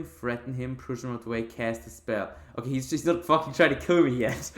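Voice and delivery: ordinary talk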